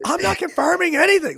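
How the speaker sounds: very high-pitched imitation of yelling; mock yelling. Retelling her snapping at her sister, mock yelling. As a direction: pitch it way up as if yelling, without real volume. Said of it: "like, yelling at her".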